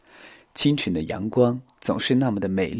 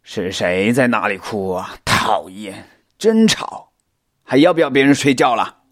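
irritated growl of a woken sleeper; grumpy spider; 6 dB louder than usual. A narrator's reading. Hidden creature woken by crying, grumpy complaint. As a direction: grumble louder as you get more annoyed.

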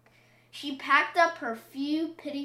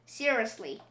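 ordinary delivery, nothing marked

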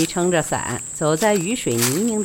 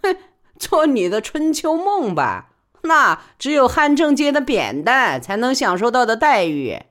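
a sneering higher, louder scoff; mocking; 7 semitones higher, 4 dB louder than usual. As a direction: scoff at her daydream. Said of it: "万小景便嘲笑道".

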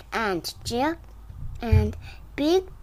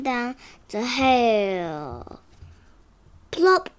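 ordinary delivery

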